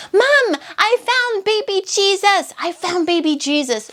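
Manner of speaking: put-on high-pitched child calling out to mom; mock yell, high and elated; excited toddler yelling. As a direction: imitate a thrilled two-year-old's big news. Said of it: "She's yelling".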